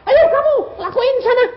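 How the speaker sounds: high, loud order; exaggerated loud commanding; mock bossy command. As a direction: shoo them off with an acted shout. Acting a bossy command, denied.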